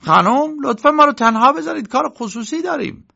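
high, loud-starting dismissal; irritated uncle character voice; annoyed uncle. Acted. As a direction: send someone out in a high, cross voice.

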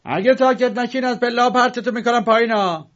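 put-on high, loud furious yell at the noisy girls; angry shout. A narrator's reading. Angry painter yelling at noisy girls outside his door. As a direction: bark the threat down the stairs. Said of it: "نقاش عصبانی در را باز کرد".

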